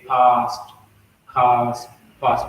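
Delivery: British long A in past and cast; British pronunciation. Shown as a demonstration. Teacher showing how British say past, British pronunciation. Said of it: "Like when British say".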